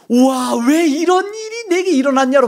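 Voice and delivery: "우와" then "왜 이런 일이 내게 일어났냐" sung out high in delight; a preacher's bright amazement, the old lament reborn as joy; joyful wonder. Retold or imitated. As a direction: turn the complaint "왜 이런 일이 내게 일어났냐" into a happy marvel. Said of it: "바뀐다니까요".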